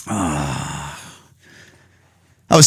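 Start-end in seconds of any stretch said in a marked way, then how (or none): 0.1-0.8 soft, low drawn-out sigh; quiet trailing sigh; long sigh. Real, not acted. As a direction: let out a long, low breath. Sighing after the rant.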